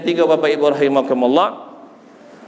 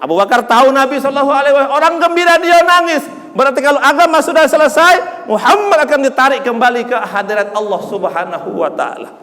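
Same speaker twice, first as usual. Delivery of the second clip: high, loud, fast preaching; rising passion; 11 semitones higher, 5 dB louder than usual. Acted. Preacher explaining Abu Bakar's tears, high and loud. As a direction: sweep them up in it.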